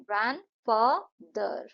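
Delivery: ग्रैंडफादर said slowly in three beats, ग्रैंड-फा-दर; syllable beats. Shown as a demonstration. Teacher counts the beats in ग्रैंडफादर. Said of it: "ग्रैंडफादर में तीन syllable हैं".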